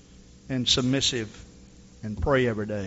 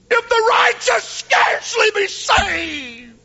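high, loud, sobbing cry of a broken man; a drunk man's desperate tearful plea; crying out to God; 6 dB louder, slower than usual. Retold or imitated. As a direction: wail it out in tears, near shouting. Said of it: "cry shout".

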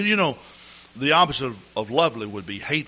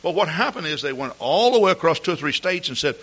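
ordinary talk

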